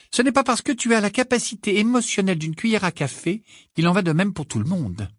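high-pitched, fierce retort. A narrator's reading. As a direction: snub him fiercely. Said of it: "d'un ton féroce". (ton féroce, voix aiguë)

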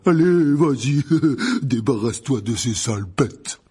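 booming jolly giant, chuckling; loud, merry encouragement; cheerful. Acted. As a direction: egg him on heartily with a laugh. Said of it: "dit Hagrid d'un ton joyeux".

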